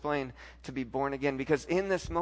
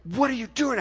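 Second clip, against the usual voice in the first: stern "What are you doing?", higher, controlled; firm mad question; 7 semitones higher than usual. Retold or imitated. Dad confronting his son over the weed killer, stern. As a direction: confront him face to face. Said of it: "I was so mad".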